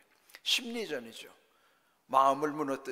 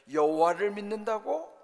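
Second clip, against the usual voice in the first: louder, rising challenge in a retold taunt; mock disbelief; 6 semitones higher, 7 dB louder than usual. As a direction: question their faith with mild scorn. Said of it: "하나님까지 이제 조롱하는 거예요". (조롱하듯 되묻는 말투)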